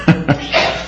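brief quiet laugh after a remark; short chuckle. Real, not acted. Preacher chuckles at his remark.

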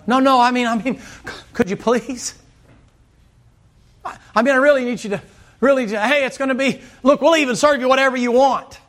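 high, hurried mock pleading, stumbling over the words; begging. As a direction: act out a friend desperately coaxing someone to dinner. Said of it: "has to be begged to come to dinner".

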